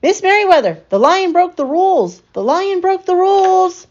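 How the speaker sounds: high, loud, breathless report; excited tattling. A narrator's reading. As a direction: tattle on the Lion at full pitch. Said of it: "he called as he walked".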